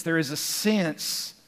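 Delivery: "sense" stretched and leaned on; slow the phrase to underline "sense"; deliberate, emphatic landing on "sense"; stress on SENSE. Shown as a demonstration. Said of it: "I want to emphasize the word sense".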